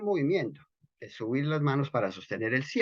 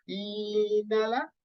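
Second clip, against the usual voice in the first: slow, drawn-out "Inhala", pitched high; a long rising "Inhala" that coaxes the breath in; breathing cue; 10 semitones higher, 4 dB quieter, much slower than usual. Shown as a demonstration. Teacher coaching students through an inhale, slow and high. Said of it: "una inhalación, pero un poco más".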